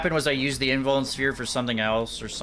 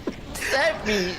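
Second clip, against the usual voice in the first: laughing disbelief; 10 semitones higher, 3 dB quieter than usual. Real, not acted. Shocked at friend's insult.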